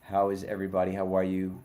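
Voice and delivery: ordinary talk, nothing marked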